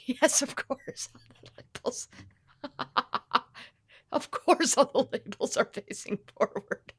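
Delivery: hushed, laughing whisper; giggling conspiratorial whisper; mock whisper. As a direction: whisper it like a guilty secret. Mock-secret aside about labels.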